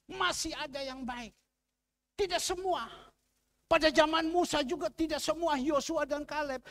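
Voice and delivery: loud, high preaching at full force; fiery preaching. Acted. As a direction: preach it hard to the congregation. (khotbah berapi-api dengan suara keras dan tinggi)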